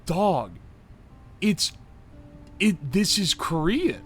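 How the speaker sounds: real exasperated outburst, rising high; riled up in disbelief; worked up. Real, not acted. Streamer riled up over a wrong guess, exasperated. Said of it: "I'm getting too worked up".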